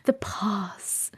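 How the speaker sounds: soft, airy "pahz" for "pause"; breathy, almost whispered open vowel. Shown as a demonstration. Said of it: "So breathy".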